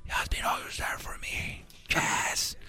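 breathy Hollywood trailer whisper; movie whisper. Retold or imitated. Mocking Hollywood romance movies, whispered. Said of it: "Hasta hablan así".

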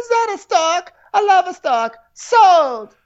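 very high, gushing voice; high Jennifer impression. Buyer falling for the stork door, very high and gushing. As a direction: squeal with delight, then snap to sold. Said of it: "She's like".

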